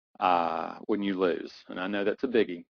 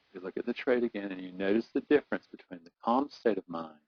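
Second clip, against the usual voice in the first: guided relaxation; 3 dB quieter than usual. Acted. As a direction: breathe them down into calm. Breathing and calming guidance.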